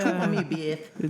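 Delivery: mock Mike Tyson voice. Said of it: "didn't sound anything like".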